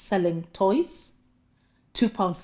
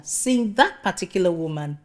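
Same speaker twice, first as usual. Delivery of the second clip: usual speaking voice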